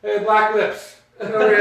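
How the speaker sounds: calling out. Retold or imitated. Teasing a coworker, loud calling out. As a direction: call him over teasingly.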